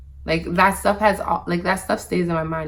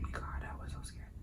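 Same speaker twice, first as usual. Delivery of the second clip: barely audible whisper; whispered aside; 19 dB quieter than usual. Real, not acted. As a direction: whisper it almost to yourself. Aside recalling her fear.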